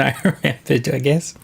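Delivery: talking while chewing; words around a mouthful of food; mouth full. Real, not acted. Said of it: "oh, I'm chewing".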